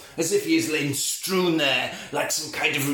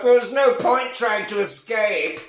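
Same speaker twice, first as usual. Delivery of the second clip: high, camp mock-French sass; sassy; 6 semitones higher, 3 dB louder than usual. Vampire taunts Lestat trying to run, high sassy voice. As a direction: taunt him in a raised theatrical voice. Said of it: "he just sasses him".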